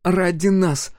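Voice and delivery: put-on high girl through gritted teeth. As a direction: force it out through clenched teeth. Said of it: "выговорила сквозь зубы Сол".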